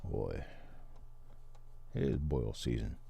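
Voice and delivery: put-on a light Cajun accent, relaxed; lightly voiced Louisiana Cajun, savoring the food; easygoing Cajun accent talking crawfish boil. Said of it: "you at least need to sound like it".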